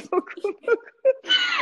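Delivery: squeezed out through laughter, very high and slow; laughing words. Real, not acted. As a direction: laugh while insisting. Rit en parlant des Américains. (dit en riant, très aigu)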